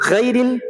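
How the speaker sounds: mock the wrong light غ in غیر; wrong thin ghain. Shown as a demonstration. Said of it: "غیر ال نہیں پڑھنا ہے". (غیر کا غین پتلا، غلط تلفظ)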